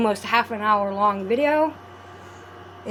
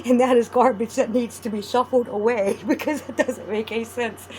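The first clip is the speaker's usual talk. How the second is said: laughing; 2 semitones higher than usual. Real, not acted. Laughs at own weird rambling.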